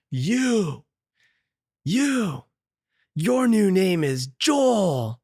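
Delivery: high, drawn-out swooping call; mock proclamation. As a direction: call it out slowly, like a grand decree. Bully proclaims from a planter.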